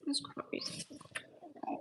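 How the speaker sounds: breathy whispered name; soft whisper. Real, not acted.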